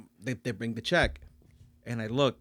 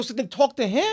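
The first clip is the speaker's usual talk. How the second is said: higher-pitched mock exasperation; retold exasperation; 9 semitones higher than usual. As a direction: brush the manager off, playfully put out. Retold argument with the manager.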